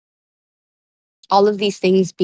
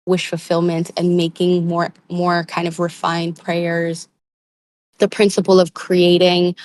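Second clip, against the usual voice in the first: ordinary talk, nothing marked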